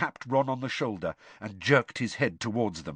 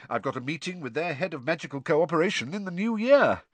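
puffed-up, raised voice; self-important Percy; 6 semitones higher than usual. Acted. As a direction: sound important. Percy continues.

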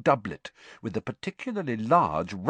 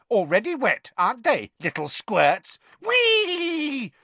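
high, loud gleeful poltergeist; Peeves cackling; 5 dB louder than usual. A narrator's reading. Poltergeist taunts pupils while lobbing water bombs, gleeful cackle. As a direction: taunt in a high imp voice, rising to a long squeal. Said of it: "cackled Peeves".

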